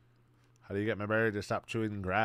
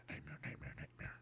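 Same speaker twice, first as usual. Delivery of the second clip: much lower, softer, eerie; spooky low murmur; 11 semitones lower, 17 dB quieter than usual. Real, not acted. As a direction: drone it like a ghost story. Repeating creepy word.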